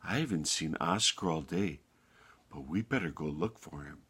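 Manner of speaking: lightly voiced low, soft shepherd; understated ominous shepherd; grave shepherd. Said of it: "And the shepherd said".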